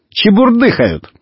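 high, keen chiming in; lightly acted boy jumping in with an answer; eager boy. A narrator's reading. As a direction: pitch it up, eagerly. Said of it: "подхватил Иван".

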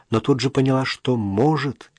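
ordinary delivery

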